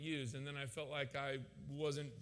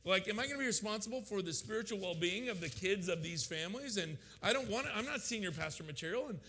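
raised pitch, stumbling, piling up worries; panicked questioning; 5 semitones higher than usual. Retold or imitated. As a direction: fire off anxious objections one after another. Overwhelmed by doubts, rattles off questions.

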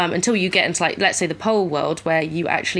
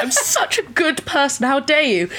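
high, laughing, hammed-up indignation; hammed-up pearl-clutching outrage, high and half-laughing; mock outraged self-defence; 7 semitones higher than usual. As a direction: play the offended innocent, pitched high and laughing. Mocking offended people who were called out.

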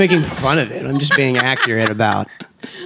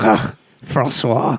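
put-on slow, haughty French accent; much slower than usual. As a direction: drawl the name like a bored Frenchman. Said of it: "He's like".

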